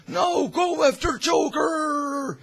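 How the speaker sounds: high, loud, slow crying shout; urgent Gordon. Retold or imitated. Gordon sends Batman after Joker, high crying shout. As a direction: beg him to go, straining. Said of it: "is like".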